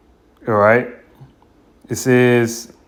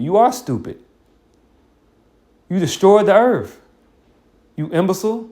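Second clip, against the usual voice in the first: scornful scolding; 8 semitones higher than usual. Real, not acted. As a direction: lash out at the idiots. Scolding the earth's destroyers.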